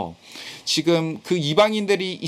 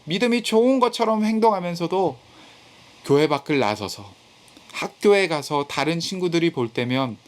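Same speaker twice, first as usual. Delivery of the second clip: ordinary delivery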